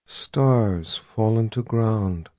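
slow, soft, hollow chant; haunting voice. Acted. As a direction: draw each word out, entranced. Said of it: "in a haunting voice".

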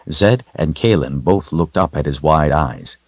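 plain reading voice

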